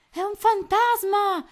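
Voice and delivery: high, loud, slow panicked cry; terrified shout. A narrator's reading. Pirate mouse seeing a ghost, terrified shout. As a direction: scream it in superstitious fright. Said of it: "gridò il topo".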